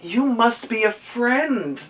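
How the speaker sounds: high, slow, gentle; tender bear. Acted. Corduroy to Lisa, slow tender high voice.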